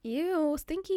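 high-pitched teasing "ew"; playful disgust. Pretend. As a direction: tease with mock disgust. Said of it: "I'm just playing".

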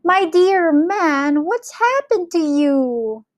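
high, slow, drawn-out outcry; alarmed cry. A narrator's reading. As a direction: cry out at your husband, stretching the words high. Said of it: "cried Missus Gregg".

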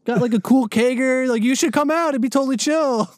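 high-pitched imitation of his own texting; hammed-up party invitation voice; mock casual invite. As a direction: pitch it way up, playing too chill. Retells own texts inviting teacher.

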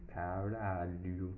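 slow, nonchalant swear; casual. Retold or imitated. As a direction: drawl it as if it were easy. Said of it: "como se fosse fácil".

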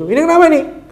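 sharp, high, loud question; suspicious recruiter. Acted. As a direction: demand what's wrong with him. Recruiter questions the injured son. (perekrut bertanya dengan suara tinggi)

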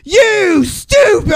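high, slow, shouted dim-witted jeer; hammed-up dumb ballplayer yelling; mock caveman shout. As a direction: yell it like a dumb brute. Mock dumb batter retort.

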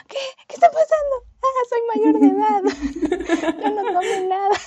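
high, loud panicked squeal, half laughing; mock panic. Retelling her panic to the police at the party, high laughing squeal. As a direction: act the scared teen protesting innocence, laughing through it.

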